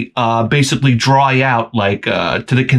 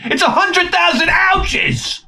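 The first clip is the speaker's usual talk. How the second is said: very high, slow, shouted punchline; hammed-up shouted punchline; exaggerated shout; slower than usual. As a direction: yell it out, dragging it slow.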